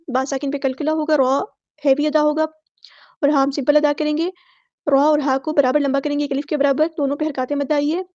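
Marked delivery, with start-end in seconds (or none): none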